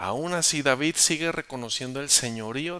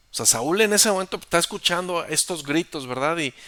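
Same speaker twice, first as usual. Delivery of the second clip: ordinary talk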